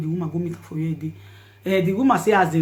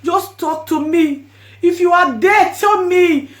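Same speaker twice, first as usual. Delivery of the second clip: high, loud, slow pleading into the phone; desperate pleading; 4 semitones higher, 7 dB louder than usual. Retold or imitated. Woman begging her dead friend to answer the phone, anguished. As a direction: beg her to answer, voice breaking.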